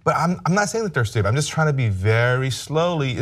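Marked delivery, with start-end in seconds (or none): 1.9-3.2 stretched-out words, carefully spaced; slow and deliberate. Shown as a demonstration. Speaker trying to help non-English speakers understand, slow and deliberate. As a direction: draw it out so a learner can follow. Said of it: "speaking slowly so they can".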